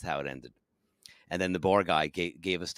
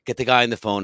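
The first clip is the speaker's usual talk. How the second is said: normal talking voice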